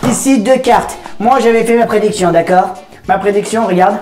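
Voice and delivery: squeaky helium-altered voice. Real, not acted. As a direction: present the trick on helium. Reveals prediction on helium. (voix à l'hélium)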